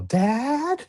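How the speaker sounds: high-pitched pretend yell for a parent; mock kid's yell. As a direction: call out like a kid pestering Dad. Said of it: "one of you just yell".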